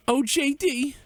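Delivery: high, mocking impression of a viewer; squeaky mock fan voice; mock donor voice.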